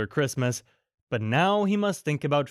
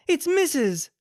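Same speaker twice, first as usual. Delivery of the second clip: high joyful cry of recognition; glad cry; 13 semitones higher, 4 dB louder than usual. A narrator's reading. Missus Dearly recognizes her dog, high joyful cry. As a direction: cry out with delight. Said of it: "cried Missus Dearly".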